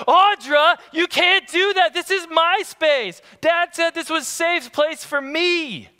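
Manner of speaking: put-on high, whiny kid voice; high-pitched child protesting; voicing upset little boy. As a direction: play the upset young son. Son Asher voiced, upset at sister.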